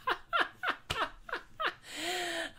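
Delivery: high cackling laugh at his own pension gag; laughing at own joke. Real, not acted. Host laughing at his own four oh one K joke, high cackle. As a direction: crack up at your own joke. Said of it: "Ah, I kill myself".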